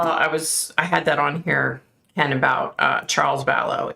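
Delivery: ordinary delivery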